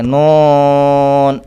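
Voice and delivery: نون sent into the nose, long and drawn out; nasal noon. Shown as a demonstration. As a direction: say نون through the nose as kids wrongly do. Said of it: "ایسے ناک کے اندر آواز لے جاتے ہیں".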